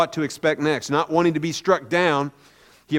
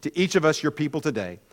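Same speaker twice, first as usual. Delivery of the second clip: lower, softer spoken prayer; 5 semitones lower, 5 dB quieter than usual. Real, not acted. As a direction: pray reverently, letting the amen drop away. Leads a prayer.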